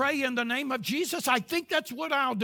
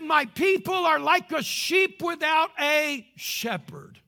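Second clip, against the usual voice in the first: slow preached quote; 5 semitones higher, 5 dB louder, slower than usual. Acted. Loud, slow quote of Jesus.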